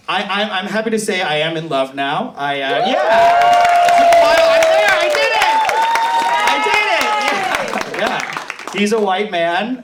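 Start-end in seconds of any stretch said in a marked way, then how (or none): 2.9-8.0 high-pitched, loud squeal of joy; giddy excitement. Real, not acted. Telling the crowd she's in love, squealing with excitement. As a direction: gush like you finally made it.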